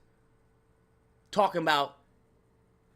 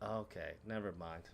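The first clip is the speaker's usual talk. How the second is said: muttered letdown; 12 semitones lower, 18 dB quieter than usual. Retold or imitated. Deflated quiet quote.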